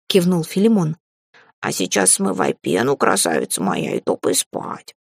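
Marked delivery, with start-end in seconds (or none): 1.6-5.0 bossy cat familiar. A narrator's reading. Cat telling his mistress to go to sleep, bossy. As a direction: send her to bed in a low cat purr.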